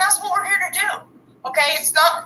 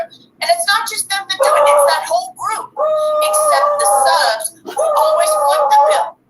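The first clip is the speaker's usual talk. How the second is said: heated ranting; 10 semitones higher, 3 dB louder than usual. Real, not acted. Heated rant at the chat.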